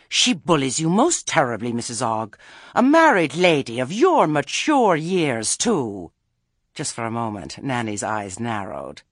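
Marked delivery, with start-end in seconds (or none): 0.1-6.1 high, loud, affronted trill; haughty Lettice. Acted. Lettice telling Nanny she is bullied, haughty. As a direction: cluck over her with patronizing outrage.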